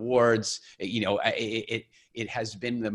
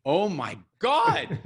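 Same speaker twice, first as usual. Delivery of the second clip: high, slow, drawn-out amazed cry; giddy disbelief; 10 semitones higher, 6 dB louder, much slower than usual. Retold or imitated. Calling his mom on his thirtieth birthday, high amazed squeal.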